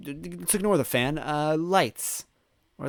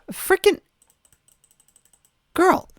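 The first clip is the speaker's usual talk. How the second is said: high, loud frustrated squeal; high squeal of frustration; exasperated outburst; 6 dB louder than usual. Real, not acted. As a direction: blurt it in disbelief. Exasperated outburst at game character.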